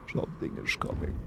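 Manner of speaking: very quiet, croaky gut-low fry; low vocal fry. Shown as a demonstration. Streamer copies the game's low voice, gut-low vocal fry. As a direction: sink into a faint, sickly vocal fry. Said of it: "a really gut low vocal fry".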